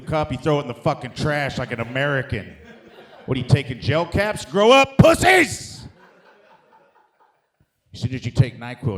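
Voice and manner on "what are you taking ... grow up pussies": a taunt that builds to a high, loud bellow; mocking yell. Comic taunting gel-cap takers, building to a shout. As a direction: roar the insult at the weaklings.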